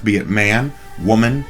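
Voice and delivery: normal talking voice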